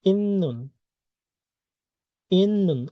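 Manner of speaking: "ইন্নুন" with a long double n, high then falling; nasal assimilation. Shown as a demonstration. Teacher answering her question, nasal assimilation. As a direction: hold the n in "ইন্নুন", drop the pitch after. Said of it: "এটার উচ্চারণ কি হবে".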